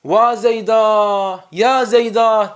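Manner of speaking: very slow, drawn-out mourning call; grieving call to the dead; wailing lament. Shown as a demonstration. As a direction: wail the name as if weeping. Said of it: "ağlayarak nasıl nida ederler".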